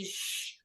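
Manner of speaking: soft breathy shush; hushing shh. Retold or imitated. Guest imitates being hushed all her life, a whispered shush. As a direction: shush someone into silence.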